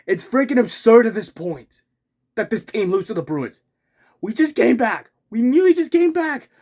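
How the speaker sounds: loud, high furious rant; frustrated shout. Real, not acted. Fan yelling about the Leafs losing to the Bruins, furious. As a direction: yell it out in disbelief. Fan ranting after painful loss.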